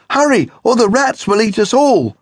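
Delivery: high, loud frightened cry; panicked sisters. Acted. Sisters in the doorway fear the rats, high loud scream. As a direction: cry out in terror.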